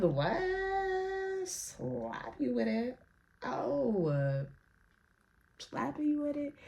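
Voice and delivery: hushed, drawn-out puzzled echo; baffled muttering. Retold or imitated. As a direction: repeat it softly and slowly, as if puzzling it out. Acting out her baffled thought.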